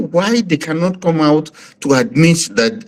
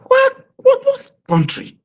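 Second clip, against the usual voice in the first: outraged cry; slower than usual. Real, not acted. Outraged guest on killings.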